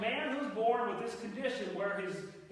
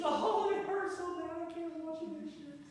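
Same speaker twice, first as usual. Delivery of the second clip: whiny excuse; 8 semitones higher than usual. Retold or imitated. Husband whining to his wife to get out of the dishes. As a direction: moan your way out of chores.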